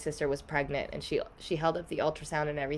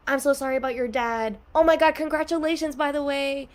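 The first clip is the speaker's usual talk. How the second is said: much higher, louder, faster gushing; mock mixed condolence; 9 semitones higher, 5 dB louder than usual. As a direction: rattle off sympathy then congratulations in one breath. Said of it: "'cause it's like".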